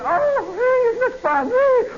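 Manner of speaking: high-pitched mock girlish thrill; excited girl. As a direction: squeal it like a thrilled girl on a ride. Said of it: "this girl has said".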